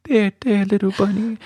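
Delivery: high, cooing there there to a tiny animal; soothing baby talk. Retold or imitated. Emily soothing the injured baby bunny in her hands, high cooing.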